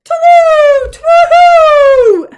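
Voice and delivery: very loud, high "twoo"; loud owl hoot. A narrator's reading. Albert answering from far away, loud hoot. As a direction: hoot "twoo" with all your might. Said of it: "hooted Albert".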